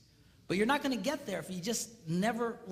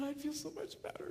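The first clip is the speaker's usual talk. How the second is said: tearful whisper; 7 semitones higher, 9 dB quieter than usual. Retold or imitated. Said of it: "she's just crying".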